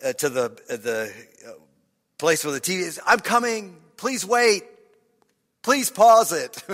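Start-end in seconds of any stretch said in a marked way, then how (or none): 3.0-6.6 high, loud call to another room; shouting upstairs. Acted. Preacher calling up to the TV room for the movie to wait, shouting. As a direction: holler out a plea to hold on. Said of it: "I'll shout up the".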